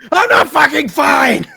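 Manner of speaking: high, drawn-out panicked yell; indignant shout. Acted. Dragged character to the ally reassuring them, shouting indignantly. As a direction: shout back at being told to calm down.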